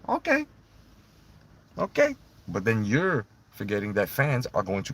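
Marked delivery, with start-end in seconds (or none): 0.1-2.2 high sarcastic rising okay. Real, not acted. Ranting at Triple H, a sarcastic high okay. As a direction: toss off a disbelieving okay.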